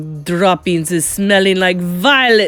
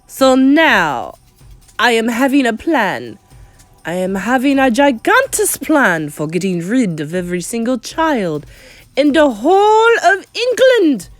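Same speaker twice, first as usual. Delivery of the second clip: loud witch proclamation, climbing high at the end; commanding, building to a high cry; barked; 5 semitones higher, 7 dB louder than usual. A narrator's reading. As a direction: announce it forcefully to a crowd. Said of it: "barked the Grand High Witch".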